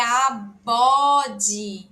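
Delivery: "a, bode" loud and split, with voiced B; emphatic B demonstration. Shown as a demonstration. Teacher shows B between two vowels, emphatic syllabic demonstration. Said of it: "Aqui já é B".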